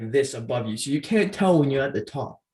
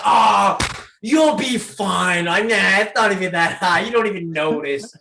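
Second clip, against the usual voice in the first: loud, raised, brushing off her fears; loud dismissive reassurance; 3 semitones higher, 5 dB louder than usual. Retold or imitated. Son to his scared mom in the queue, loudly dismissive. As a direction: wave the worry away, loudly.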